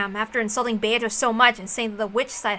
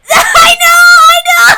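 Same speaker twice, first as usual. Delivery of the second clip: high, loud shouting through laughter; real howling laughter; laughing shout; 16 dB louder, slower than usual. Real, not acted. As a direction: lose it, yelling between laughs. Streamer cracking up at joke.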